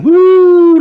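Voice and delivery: high, loud "woo" cheer; excited whoop. Real, not acted. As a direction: let out a high celebratory woo. Cheering a viewer in chat.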